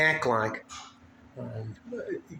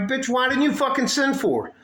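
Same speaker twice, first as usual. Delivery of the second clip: higher, louder angry snap back; angry retort; 10 semitones higher, 4 dB louder than usual. Retold or imitated. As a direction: fire it back at her, furious and high. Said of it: "and I'm like".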